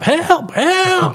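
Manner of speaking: a naked man bursting in begging for help; hammed-up high panicked yell; mock cry for help. Running in naked, calling help.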